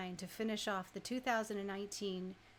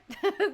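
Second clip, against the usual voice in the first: high, loud burst of laughter; 9 semitones higher, 10 dB louder than usual. Real, not acted. Host laughing about faking crowd cheers. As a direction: crack up at the idea.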